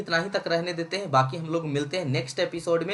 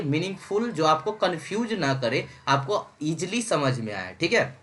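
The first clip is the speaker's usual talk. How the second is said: normal talking voice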